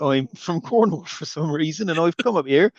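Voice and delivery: put-on a lightly done West Country accent; a friendly West Country greeting. Mocking the film's villagers, in a light West Country accent. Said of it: "the only accent I can do".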